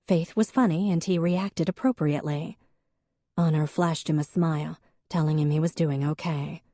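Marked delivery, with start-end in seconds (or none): none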